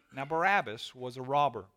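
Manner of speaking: normal talking voice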